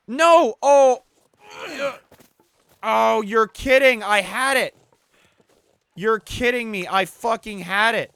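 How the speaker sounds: loud, high cries of disbelief; frustrated shout. Real, not acted. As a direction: yell at the screen as it slips away. Rabbit escapes.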